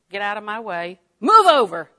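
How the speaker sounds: hostile shove. Retold or imitated. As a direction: shove the person out of your way. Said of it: "acted hostile shove".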